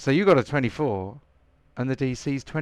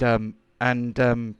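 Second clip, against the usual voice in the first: ordinary delivery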